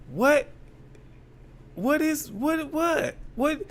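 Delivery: high, baffled what, what; incredulous what. Real, not acted. Commentator reacting to a suspect's odd answer, incredulous. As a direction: pitch jumping up in disbelief.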